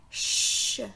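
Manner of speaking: "ch" as a voiceless, sustained shush; isolated CH sound. Shown as a demonstration. Teacher revealing the repeated sound, "ch" as a long shush. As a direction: sound out "ch" alone, not as letter names. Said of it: "c'est le son".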